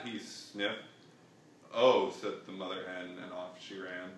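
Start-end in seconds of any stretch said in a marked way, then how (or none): 1.7-2.1 surprised oh. Acted. Mother hen startled exclamation.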